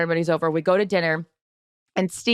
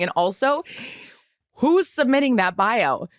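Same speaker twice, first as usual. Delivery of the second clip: ordinary delivery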